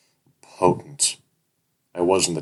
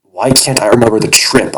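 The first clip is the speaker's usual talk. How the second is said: higher, louder demand; 6 semitones higher, 13 dB louder than usual. Acted. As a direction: insist on an answer, higher. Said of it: "I demand".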